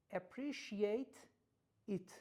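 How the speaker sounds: APPRECIATE, then IT as two separate words, slowly; slow "Appreciate ... it", unlinked; separated words. Shown as a demonstration. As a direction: say "Appreciate" with a full final t, pause, then "it".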